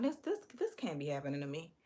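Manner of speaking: soft, quick stammer of mock dismay; hushed disbelief. Woman reacting privately to her date's lipo confession, hushed disbelief. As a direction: mumble it to yourself, stunned.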